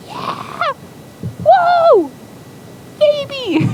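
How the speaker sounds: very high, loud falsetto cheering; ecstatic squeal. Acted. Zip cheering when offered the baby, ecstatic squeal. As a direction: squeal with joy like an overexcited kid.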